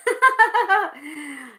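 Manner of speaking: short, high burst of giggles; real laughter. Real, not acted. Host amused while reading the live chat, real laughter. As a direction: break into a quick high laugh.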